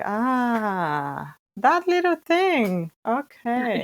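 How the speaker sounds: retold realization. Coder relives finally spotting the tiny bug, amused realization. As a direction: act out the eureka moment after hours of debugging, half amused. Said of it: "and I'm like".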